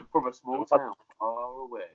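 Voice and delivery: slow mock movie-trailer voice; lightly hammed narrator. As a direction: intone it like a film trailer. Trailer-voice joke.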